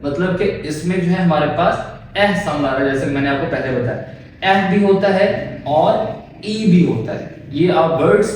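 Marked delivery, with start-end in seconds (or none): none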